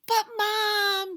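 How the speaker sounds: put-on high breathless gasp of a scared boy; the little boy gasps as mum leaves. A narrator's reading. Gasping boy. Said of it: "gasped Eddie".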